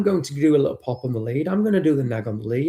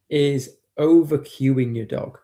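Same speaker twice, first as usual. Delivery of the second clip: ordinary delivery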